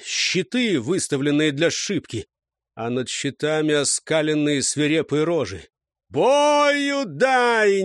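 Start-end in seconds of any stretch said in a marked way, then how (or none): 6.1-7.8 high, sustained battle roar; booming roar. A narrator's reading. Зарник suddenly roars his squad into battle, booming roar. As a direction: roar the charge to the men. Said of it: "густым зычным голосом взревел Зарник".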